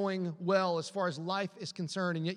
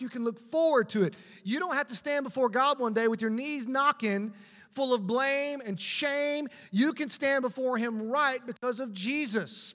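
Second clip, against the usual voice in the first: loud, higher, slowed scripture proclamation; full-force preaching; 4 semitones higher, 3 dB louder, slower than usual. Acted. Pastor booming the verses to the congregation, loud and slow. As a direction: proclaim each word to the back row.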